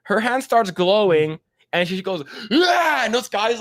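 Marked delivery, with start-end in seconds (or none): 2.5-3.0 short high, loud "Ah!"; mock attack cry, pitched way up; imitated battle yell. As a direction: burst out with a comic yell. Imitates woman's power-up yell.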